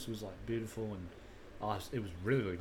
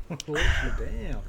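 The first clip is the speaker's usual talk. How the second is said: louder, higher, drawn-out tease; loud slow tease; 6 semitones higher, 12 dB louder, much slower than usual. Real, not acted. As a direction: stretch it out mockingly. Teasing echo.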